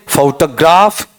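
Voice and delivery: फोटोग्राफ slowly as "fau-ta-GRAAF", rising; फोटोग्राफ drawn out with a heavy last syllable; third variant. Shown as a demonstration. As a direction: lean on the final "graaf" of फोटोग्राफ. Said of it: "मैं चारों बोल देता हूं".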